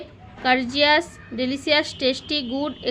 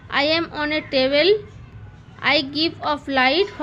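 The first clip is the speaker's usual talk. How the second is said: slow, careful teacher reading; slow riddle reading; much slower than usual. Shown as a demonstration. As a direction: read the riddle slowly to a child. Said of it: "teacher reads riddle slowly". (धीरे-धीरे पहेली पढ़ना)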